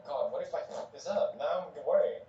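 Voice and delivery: ordinary talk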